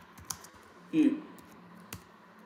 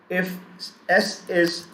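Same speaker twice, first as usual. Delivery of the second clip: usual speaking voice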